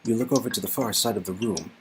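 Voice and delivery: ordinary delivery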